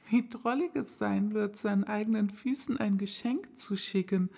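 put-on girl's voice, playfully dictating an address; Alice tickled by her own idea; amused little girl. Alice amused, reciting the address for her right foot.

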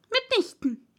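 high, firm, cross mouse voice; a lightly voiced offended little mouse; annoyed mouse. A narrator's reading. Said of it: "sagte die Maus entschieden und sehr ärgerlich".

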